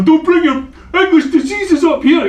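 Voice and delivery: hammed-up yell like an angry border protester; imitated nationalist shout. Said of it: "shouting at English cars".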